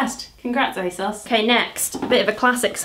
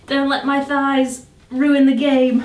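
slow, louder pleading while straining; strained plea; 4 semitones higher, 4 dB louder, slower than usual. Real, not acted. Squeezing thighs into jeans, slow strained plea. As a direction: beg through the effort.

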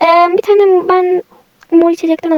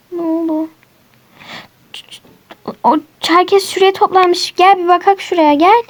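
ordinary delivery

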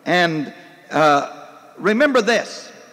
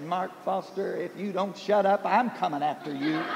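a hint of a groggy, softer threat; sleepy wife; 10 dB quieter than usual. Wife woken by his shouting, lightly voiced soft sleepy threat. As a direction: mutter it, half-awake and annoyed. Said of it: "she opened one eye and said".

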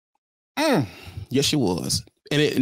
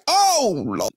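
surprised; 4 semitones higher than usual. Real, not acted. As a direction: react shocked to the message. Reacting to the text.

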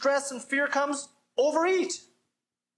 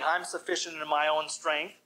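lower-voiced smug general, understated; 8 semitones lower than usual. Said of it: "General Custer replied rather smugly".

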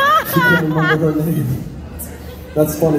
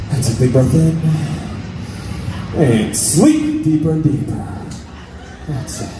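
hypnotized dreamy voice; 3 semitones lower than usual. Acted. Hypnotist induction.

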